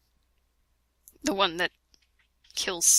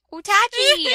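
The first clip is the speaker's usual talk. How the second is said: mock girly call; 11 semitones higher, 5 dB louder than usual. Reading fanfic heroine line.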